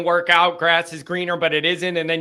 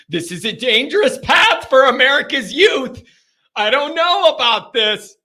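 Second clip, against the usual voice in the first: loud, high-pitched comic warning; hammed-up outraged concern; mock alarm; 6 semitones higher, 6 dB louder than usual. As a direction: sound the alarm, half laughing. Mock alarm at child copying him.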